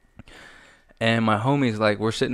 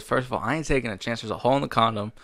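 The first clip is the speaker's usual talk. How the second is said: usual speaking voice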